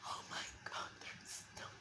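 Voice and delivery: breathless hushed whisper of wonder; hushed, amazed whisper; awed whisper. Retold or imitated. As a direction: whisper it as if you can't believe your eyes. Quoted reaction to seeing snow.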